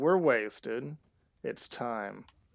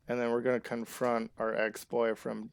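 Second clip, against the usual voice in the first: ordinary delivery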